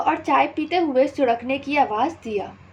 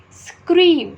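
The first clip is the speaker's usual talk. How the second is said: loud, high word scream; mock scream; 5 semitones higher, 7 dB louder than usual. Shown as a demonstration. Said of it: "scream मतलब चीखना या चिल्लाना". (ज़ोर से ऊँची आवाज़ में स्क्रीम)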